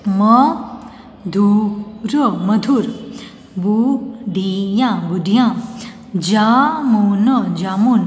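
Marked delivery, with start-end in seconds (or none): 0.1-2.3 म, धु, र with long pauses between sounds; syllable by syllable. Shown as a demonstration. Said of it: "sound pronounce करके देख लीजिए". 3.6-4.9 बुढ़िया very slow in separate pieces; syllable by syllable. Shown as a demonstration. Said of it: "sound pronounce करके देख लीजिए". 6.2-7.6 जामुन very slow, each sound held; syllable by syllable. Shown as a demonstration. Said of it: "sound pronounce करके देख लीजिए".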